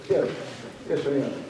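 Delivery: normal talking voice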